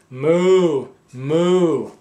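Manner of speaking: a loud, hammed-up moo; cow moo. Man reading a farm picture book, mooing loudly.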